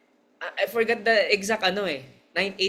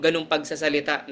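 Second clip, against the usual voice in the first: normal talking voice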